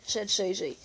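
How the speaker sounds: ordinary delivery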